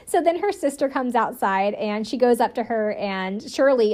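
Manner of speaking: ordinary talk, nothing marked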